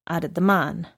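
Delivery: ordinary delivery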